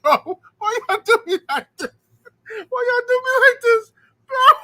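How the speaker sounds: high, loud, pleading through laughter; laughing mock whine. Retelling how he complained to everyone, laughing high whine. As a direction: whine it out while cracking up. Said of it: "I'm like".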